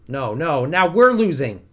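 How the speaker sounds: loud rising cry of dismay; alarmed outburst. Real, not acted. Streamer seeing his war turn, alarmed loud cry. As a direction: shout it as the front collapses.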